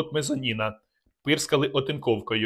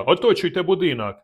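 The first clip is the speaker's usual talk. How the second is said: loud, higher battle command; shouted order; 5 semitones higher, 4 dB louder than usual. Acted. Narrator commanding men to surround the house, shouting. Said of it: "крикнув я".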